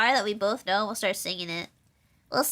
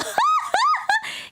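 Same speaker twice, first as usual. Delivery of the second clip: shrieking laugh. Real, not acted. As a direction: let out a shrill laugh. Laughing at herself.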